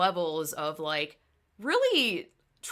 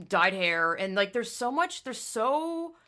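ordinary talk

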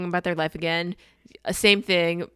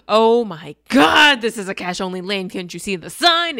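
explode, stretching the first words; hammed-up freak-out in the queue; mad customer; 2 semitones higher, 9 dB louder than usual. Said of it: "He's so mad".

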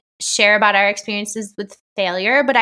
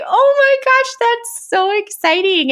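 much higher, squealing oh my gosh, slowing on so exciting; gushing excitement; 14 semitones higher, 5 dB louder than usual. Retold or imitated. Retelling meeting a listener, squealing with much higher excitement. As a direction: gush like a starstruck fan meeting you. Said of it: "I was like".

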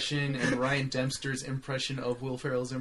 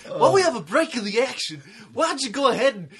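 Harry Carey impression; 9 semitones higher, 8 dB louder than usual. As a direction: boom it out like an old baseball announcer. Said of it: "do a little Harry Carey".

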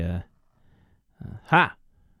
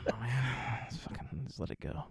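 resigned mutter; 15 dB quieter than usual. Real, not acted. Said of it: "resigned, muttering".

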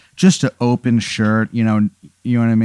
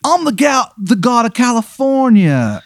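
much higher, proud surfer boast; surfer god voice; 12 semitones higher than usual. Acted. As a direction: announce yourself as a beach deity. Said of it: "He goes".

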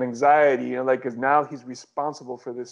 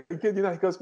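ordinary talk, nothing marked

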